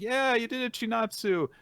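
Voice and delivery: high, excited team cheer; teammates cheering. Retold or imitated. Teammates celebrating Chinatsu's win, high excited cheer. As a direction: cheer her on like a whole team. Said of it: "they're like".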